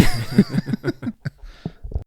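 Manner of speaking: airy, unvoiced high laugh; breathy laugh. Real, not acted. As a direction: let out a breathy giggle. Laughing at own joke.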